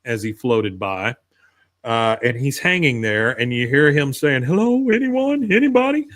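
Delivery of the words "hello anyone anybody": calling for help. Retold or imitated. Bill stranded on chimney calls out.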